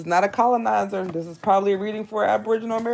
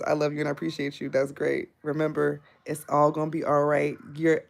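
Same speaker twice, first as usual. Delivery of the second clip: normal talking voice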